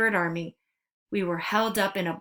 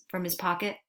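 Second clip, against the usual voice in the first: ordinary delivery, nothing marked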